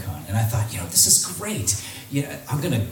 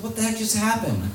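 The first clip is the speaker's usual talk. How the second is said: 1 baffled; 11 semitones higher than usual. Retold or imitated. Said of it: "And I was like".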